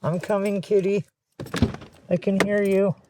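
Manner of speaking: high-pitched pet-talk; calling to kitty. Real, not acted. Answering a crying kitty she is going to find, high-pitched calling.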